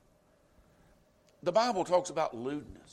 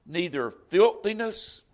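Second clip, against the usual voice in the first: slow, louder solemn reading voice; scripture reading; 7 dB louder, slower than usual. Acted. Preacher reading the verse to his congregation, slow and solemn. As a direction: read it out like holy writ to the church.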